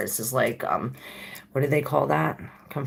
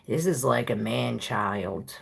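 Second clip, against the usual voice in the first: ordinary delivery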